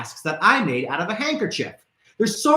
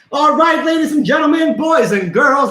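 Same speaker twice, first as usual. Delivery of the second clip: loud, high showman call; announcer shout; 7 semitones higher, 5 dB louder than usual. Real, not acted. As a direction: call out to the crowd like a ringmaster. Host announcing to audience.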